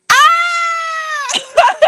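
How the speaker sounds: very high, long squeal; gleeful shriek. Real, not acted. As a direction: shriek with laughter, high and sustained. Laughing outburst about her avatar.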